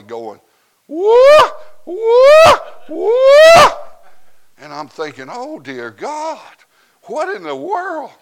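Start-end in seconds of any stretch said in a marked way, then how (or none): 0.9-3.7 very high, loud whoops; imitated church shouting. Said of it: "gets up and starts shouting". 5.3-8.1 startled inner reaction. Retold or imitated. Startled thought at sudden whooping.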